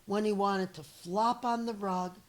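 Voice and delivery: lively read-aloud storyteller voice; story narration. Acted. Teacher reading a picture book to her students, lively storytelling. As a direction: read it to young kids, expressive and clear.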